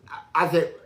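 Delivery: usual speaking voice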